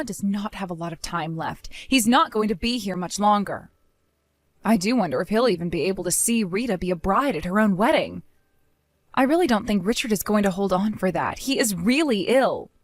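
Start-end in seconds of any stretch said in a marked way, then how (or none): none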